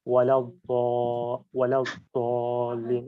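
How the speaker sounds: ওয়ালা দলিন with a thick, heavy দ from the right gum; thick wrong sound. Shown as a demonstration. Teacher shows students the wrong thick দ, heavy. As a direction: say দ too thick on purpose. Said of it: "একটু মোটা আওয়াজ শোনাবে".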